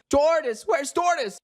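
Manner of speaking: high mock yell, calling out. A narrator's reading. Searchers arrive hunting for Tortoise, yelling. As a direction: call out urgently in a high voice. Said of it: "gets there yelling".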